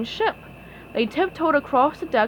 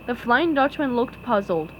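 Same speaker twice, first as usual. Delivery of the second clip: plain reading voice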